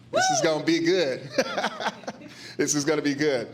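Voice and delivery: chuckling through the words; laughing. Real, not acted. Host to luncheon guests, laughing as she introduces the topic. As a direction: let a real laugh break into the line.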